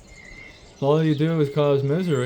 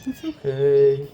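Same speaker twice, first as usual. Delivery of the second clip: slow reassurance; 4 semitones higher, much slower than usual. Real, not acted. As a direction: stretch it out to calm them. Reassuring the birds slowly.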